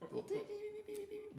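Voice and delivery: high, soft imitated bird beeps; bird chirps. Shown as a demonstration. Preacher imitating the bird sounds played in the mall, high soft chirps. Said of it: "bird sounds".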